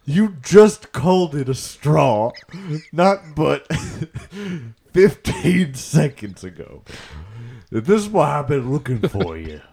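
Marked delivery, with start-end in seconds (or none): 0.0-6.8 raised, loud Louisiana protest; exasperated Southern complaint; indignant. Acted. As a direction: call them out, high and loud. Calls out the host's contradiction.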